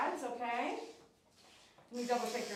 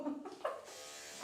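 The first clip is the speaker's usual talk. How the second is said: breathy giggle; 4 semitones higher, 8 dB quieter than usual. Real, not acted. Laughs at dog.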